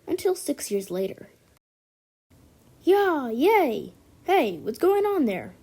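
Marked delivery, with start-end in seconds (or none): 2.8-5.5 cheering. Acted. Settlers cheering, then calling out.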